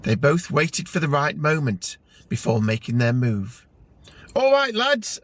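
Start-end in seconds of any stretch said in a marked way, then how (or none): 4.3-5.2 mild boom, much higher pitched; booming greeting. A narrator's reading. Vinny greets strangers at their table, booming a little too loudly. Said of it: "Vinny boomed, a little too loudly".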